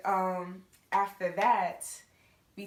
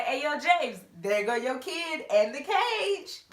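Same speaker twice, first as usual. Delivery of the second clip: higher, louder taunting call; teasing call; 7 semitones higher, 5 dB louder than usual. Retold or imitated. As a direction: tease him across the hall. Kids teasing James in hallway.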